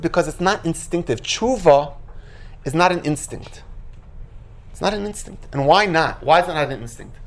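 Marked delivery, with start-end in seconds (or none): none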